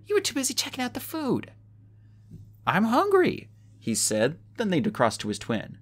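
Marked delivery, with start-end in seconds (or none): 0.1-1.5 put-on high, light girlish teasing; teasing sister. Sophie teasing Josh at the table, in a high girlish voice. 2.7-3.5 put-on high, loud boyish protest; Josh's voice. Josh answering his sister, in a high loud boyish voice.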